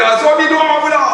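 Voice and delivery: high, loud teasing call; urging the husband. Retold or imitated. Preacher teasing husbands to pray, high loud call. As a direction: goad the husband to pray now. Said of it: "Saya kata".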